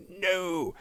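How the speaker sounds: high-pitched, playful drawn-out no; mock emphatic no. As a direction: answer your own question with silly emphasis. Mock answer to own rhetorical question.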